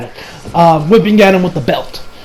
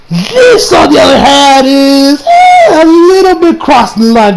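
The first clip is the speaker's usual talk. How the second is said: exclaiming; 7 semitones higher, 4 dB louder, slower than usual. Real, not acted. Reacting to shocking scene.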